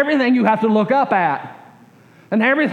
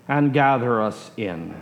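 ordinary talk

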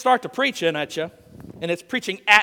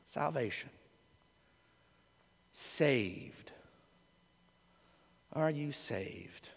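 hushed; 7 semitones lower, 16 dB quieter, much slower than usual. Acted. Hushed reflection on words.

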